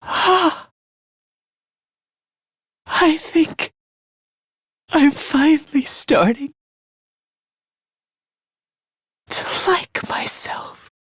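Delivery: halting, breath running out to a whisper; fading last words. Acted. Finally starting to like myself, fading last words. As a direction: let the voice give out slowly.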